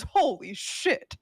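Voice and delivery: normal talking voice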